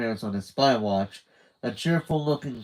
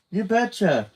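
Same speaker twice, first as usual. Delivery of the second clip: higher, chirpy North Dakota twang; North Dakota accent; 10 semitones higher, 5 dB louder than usual. A narrator's reading. Candy chipping in with a North Dakota accent. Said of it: "with a twangy North Dakota accent".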